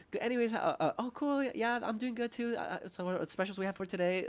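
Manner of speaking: high, hurried awkward server voice; lightly mocked nervous waiter; flustered waiter. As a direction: brush past it and get to the specials. Imitating flustered waiter moving on.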